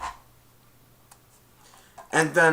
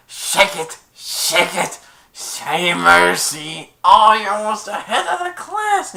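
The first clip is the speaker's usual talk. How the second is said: louder put-on voice, very slow on "say mercy"; hammed-up principal; 7 semitones higher, 9 dB louder, slower than usual. Principal on the toilet, louder and hammed-up. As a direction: ham it up like a silly principal.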